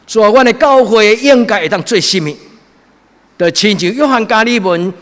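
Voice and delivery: high, loud impassioned sermon; fervent preaching. Acted. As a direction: preach it with full fire. Preaching at full force.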